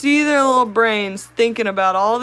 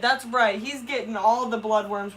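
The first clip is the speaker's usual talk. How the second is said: ordinary talk, nothing marked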